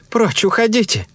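high, forceful order to leave; loud command. A narrator's reading. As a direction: call out loudly, pitched high. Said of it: "громко сказала".

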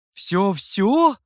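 rising, doubtful Всё-всё? asked back; checking question. A narrator's reading. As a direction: ask Всё-всё? with a lifting, unsure end. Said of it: "уточнил хозяин".

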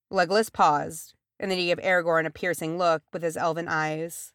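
ordinary delivery

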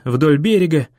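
ordinary delivery

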